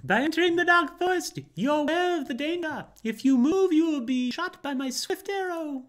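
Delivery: very high, loud archer; shrill forest archer threatening the paper traveler. Acted. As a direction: warn them off from the trees. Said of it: "Okay, the archer".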